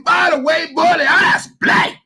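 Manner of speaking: big theatrical announcement, laughing through it; hammy stage actor. Retold or imitated. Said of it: "I'm gonna use my best acting voice".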